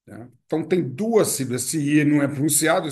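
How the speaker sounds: ordinary talk, nothing marked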